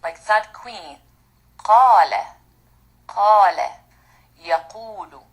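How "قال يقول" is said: ordinary talk, nothing marked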